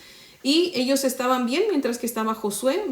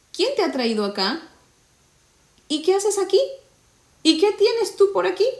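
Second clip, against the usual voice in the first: much higher, louder voice of surprised men; questioning strangers; 10 semitones higher, 4 dB louder than usual. Acted. Men of Dan confront the Levite, high suspicious questioning. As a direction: challenge him with sudden suspicion.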